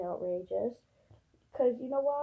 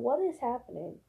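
usual speaking voice

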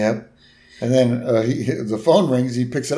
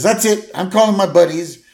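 higher, louder huffy declaration; Homer fed up; 7 semitones higher, 5 dB louder than usual. Retold or imitated. Homer deciding to go out, huffy and loud. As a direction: storm off in a huff. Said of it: "He says".

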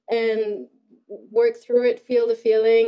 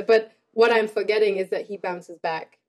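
ordinary delivery

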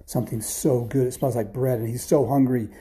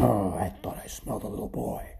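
grumbling giant; 2 semitones lower than usual. A narrator's reading. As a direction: grumble to yourself like a put-out giant. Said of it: "he sat down grumbling".

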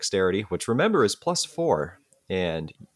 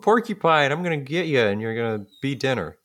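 high angry scientist voice; 7 semitones higher than usual. Acted. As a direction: threaten the porcupine in a high shout that fades. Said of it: "He's like".

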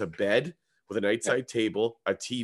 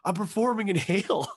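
much higher, pleading voice; frantic explaining; 11 semitones higher than usual. Retold or imitated. Comedian justifying himself to the hostile woman, frantic. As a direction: explain yourself in acted panic.

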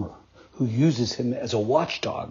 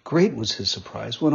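usual speaking voice

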